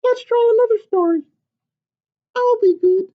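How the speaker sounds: high, loud, eager chick's squeak; a tiny excited hen butting in; little chicken voice. Acted. Chicken bursting into papa's story, high and eager.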